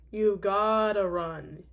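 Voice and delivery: You gotta run, slow, loud, every word hit; slow emphatic. Real, not acted. Repeated warning, drawn out.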